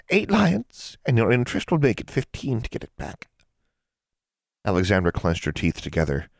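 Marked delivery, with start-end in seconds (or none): 0.1-3.3 lightly voiced scheming hag, a touch higher; an old witch toying with a girl; sly old hag. A narrator's reading. Hag bargaining with a young witch, lightly sly.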